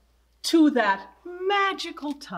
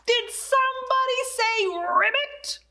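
much higher, incredulous question; a high, astonished did somebody say ribbit; shocked; 10 semitones higher than usual. Acted. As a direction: sound amazed somebody said ribbit.

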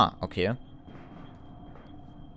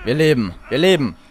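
relieved; 5 semitones higher, 7 dB louder than usual. Real, not acted. Survived the attack.

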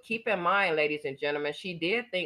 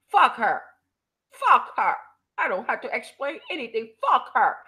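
loud, higher, spat out hard; mock angry outburst; 6 semitones higher, 6 dB louder than usual. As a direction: bark it like her furious rant. Mimicking her angry outburst.

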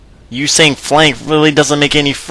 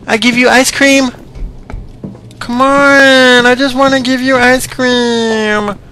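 higher, sing-song creepy stranger voice; creepy candy man; 10 semitones higher than usual. Acted. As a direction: lure them in like a creepy ice cream man.

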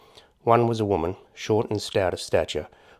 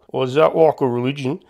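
puzzled question, higher and louder; confused; 6 semitones higher, 7 dB louder than usual. Acted. Hamish asking strangers about an unknown word, confused. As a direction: ask it baffled, pitched up.

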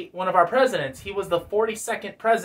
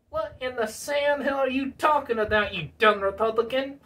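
high, exasperated puppet voice; Bill Clinton puppet; 4 semitones higher than usual. Acted. Puppet baffled by handler's explanation, exasperated. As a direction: scoff in a put-on character voice. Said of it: "Bill Clinton".

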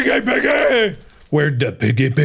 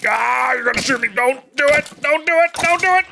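mock panic; 9 semitones higher than usual. Skeleton about to shoot.

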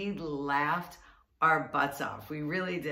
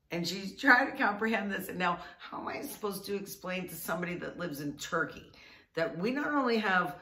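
ordinary talk

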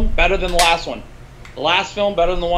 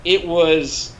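ordinary talk, nothing marked

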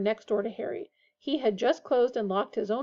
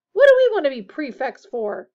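loud, higher incredulous protest; revolted; 4 semitones higher, 10 dB louder than usual. A narrator's reading. George objects to becoming a prefect, revolted. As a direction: recoil from the very idea. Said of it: "looking revolted at the very idea".

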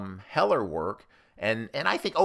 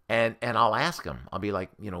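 ordinary talk, nothing marked

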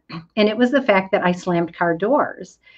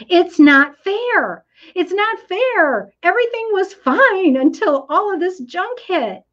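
whiny complaint; 10 semitones higher than usual. Pretend. Parent of schoolchildren complaining about the pandemic, whining.

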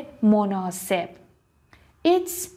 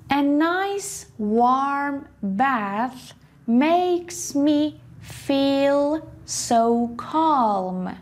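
slow, raised reading; very slow model sentence; 4 semitones higher, much slower than usual. Shown as a demonstration. Teacher reading an example sentence slowly. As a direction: read it out slowly and clearly. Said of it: "model sentence read slowly".

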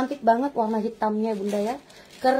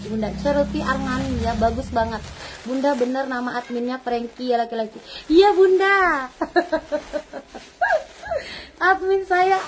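normal talking voice

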